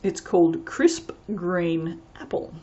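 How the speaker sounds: "Crisp Green Apple" said slowly, every sound crisp; slow, enunciated. Shown as a demonstration. Naming the apple fragrance, slow and carefully enunciated. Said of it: "if I talk slower and enunciate".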